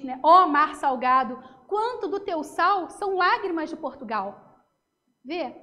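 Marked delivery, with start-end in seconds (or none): 0.2-4.6 slow, declaimed verse; solemn poem recital. Shown as a demonstration. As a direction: recite it to the sea. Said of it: "Tem um poema que diz".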